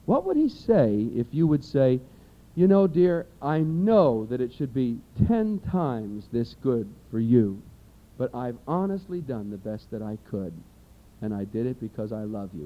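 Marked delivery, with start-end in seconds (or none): 2.6-12.6 a sincere, tender apology to a husband; gentle wife. Retold or imitated.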